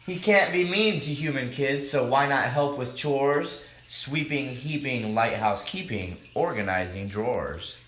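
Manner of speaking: lively rhyming storybook read-aloud; reading to children. A narrator's reading. Host reading a picture book to children, lively. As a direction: read the rhymes out to the kids.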